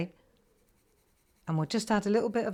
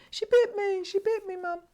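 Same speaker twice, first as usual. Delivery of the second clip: put-on much higher whiny child's voice; crying little boy. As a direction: whimper it like a hurt small son. Crying son's complaint imitated.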